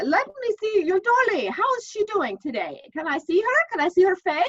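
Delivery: put-on high, loud Irish brogue coaxing a child; an Irish priest gently asking to see the doll; Irish priest accent. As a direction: coax her in a thick Irish brogue. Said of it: "so excuse the accent".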